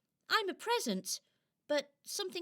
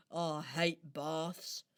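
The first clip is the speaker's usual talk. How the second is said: grumbling; 8 semitones lower, 5 dB quieter, slower than usual. Acted. Robin grumbles low.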